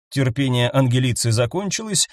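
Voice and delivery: ordinary delivery, nothing marked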